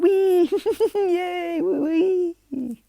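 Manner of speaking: loud falsetto squeals with giggles; gleeful laughing. Acted. Izuku laughing as he falls from the sky, loud gleeful squealing. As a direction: manic high-pitched whee. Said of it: "he's laughing, like, going, like".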